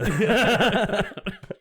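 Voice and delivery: big burst of laughter; high ringing laugh; loud laugh. Real, not acted. Laughing at own joke.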